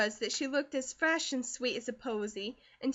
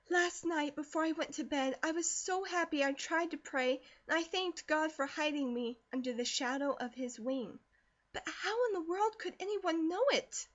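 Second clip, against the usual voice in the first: plain reading voice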